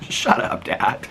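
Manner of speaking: breathless, amused mock protest; laughing retort. Son answering dad's teasing about getting older, laughing. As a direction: laugh through a retold comeback, voice giving out.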